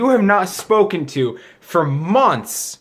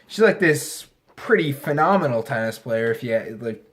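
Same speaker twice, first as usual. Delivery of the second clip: ordinary talk, nothing marked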